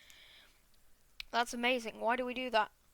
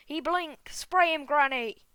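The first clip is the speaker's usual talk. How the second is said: high, loud cry of alarm; panicked shout; 4 semitones higher, 8 dB louder, slower than usual. Acted. Passengers alarmed by a bee in the car, panicked shout.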